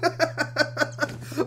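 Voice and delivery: breathless laugh after losing; helpless laugh. Real, not acted. Gamer laughs at his own death in the game, breathless. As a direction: laugh it off in disbelief.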